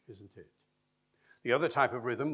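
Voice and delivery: ordinary delivery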